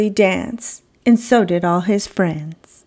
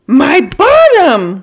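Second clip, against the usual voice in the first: loud high slow cry of shock; alarmed cry; 6 semitones higher, 9 dB louder, slower than usual. Acted. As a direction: cry out in dismay. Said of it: "cried Bernard".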